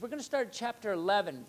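ordinary delivery

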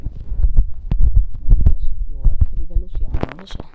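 very loud, slow, strained groan; exasperated groan. Real, not acted. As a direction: howl out the defeat. Defeated by levels.

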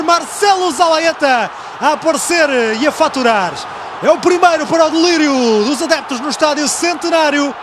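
building to a high, loud goal shout; fast dramatic football commentary. Real, not acted. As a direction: call the goal as it happens. Goal call in live commentary.

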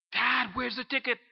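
panicked. Retold or imitated. Son phoning his father about a missing ticket, panicked. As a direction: blurt it out in alarm.

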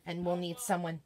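normal talking voice

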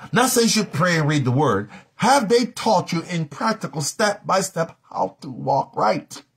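high, forceful, insistent challenge; impassioned preaching. Real, not acted. Preacher challenging what believers were taught, high impassioned questioning.

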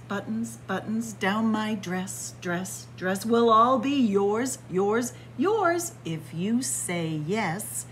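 plain reading voice

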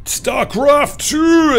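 big slow announcer reveal; dramatic announcement. Real, not acted. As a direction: stretch it out like a reveal.

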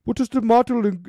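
high, loud put-on character voice; Klaus Schwab voice. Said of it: "that's my Klaus Schwab voice".

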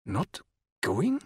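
halting, rising disbelief; pause, then pitch leaps up on the question; incredulous amazement. A narrator's reading. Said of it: "cried in a tone of incredulous amazement".

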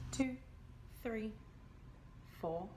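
ordinary delivery, nothing marked

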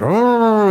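high loud mock oh; a playful mock groan. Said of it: "children in the room mocking me".

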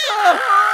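high scream breaking into a frantic laugh; frightened scream into laugh. Acted. Fluttershy facing the cockatrice, screaming into hysterical laughter. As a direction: terror tipping into hysteria.

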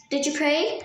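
ordinary talk, nothing marked

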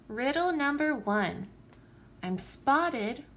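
plain reading voice

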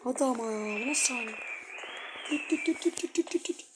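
soft, low tense murmur; hushed voice. Real, not acted. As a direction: keep it down so no one hears. Hushed after grandma threat. (kısık sesle gergin)

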